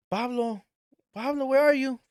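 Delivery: calling the pet's name, rising to a shout; calling for a lost pet through the trailer. Retold or imitated.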